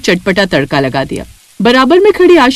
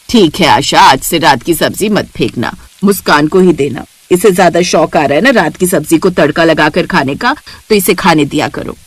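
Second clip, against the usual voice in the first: sarcastic mother-in-law; 5 semitones lower than usual. Acted. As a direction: lower older woman, dismissive. Mother-in-law mocks Muskan. (ताना मारती सास, भारी आवाज़)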